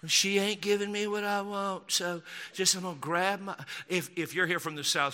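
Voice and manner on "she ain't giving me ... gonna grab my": complaining Southern husband, hammed up; mocking Southern grumble; mock Southern accent. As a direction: put on a Southern good-old-boy voice. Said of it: "all of my mockery accents are from the South".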